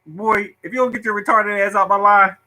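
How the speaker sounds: mock angry lunch lady. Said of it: "they're looking at me like".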